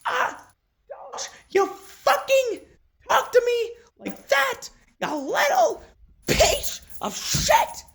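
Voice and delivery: squeaky falsetto mouse voice, very high; mock a cursing cartoon mouse in piercing falsetto; hammed-up high cartoon scolding, slowed on the swearing; Mickey. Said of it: "you literally said".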